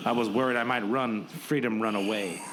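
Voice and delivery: usual speaking voice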